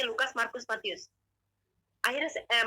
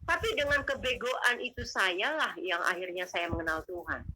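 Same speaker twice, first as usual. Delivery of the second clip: normal talking voice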